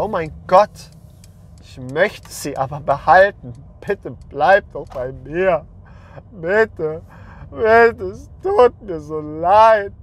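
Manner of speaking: pleading that builds to a hammed-up high sob; mocking a man begging girlfriend to stay, ending in a high wail; mock desperate begging. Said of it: "Merkt ihr, wie ekelhaft das ist?".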